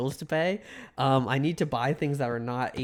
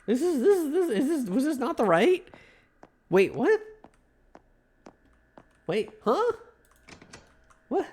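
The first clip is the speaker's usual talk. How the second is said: high-pitched, baffled disbelief; surprised; 9 semitones higher than usual. Real, not acted. Player confused when the game overrides his path, high-pitched disbelief. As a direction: voice jumps up in shock at the game.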